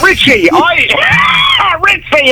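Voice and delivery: high, slow, drawn-out call; hammed-up Christmas character. Santa's brother addressing Richie about his wish list, hammed-up greeting. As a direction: stretch it out like a comic Santa's helper.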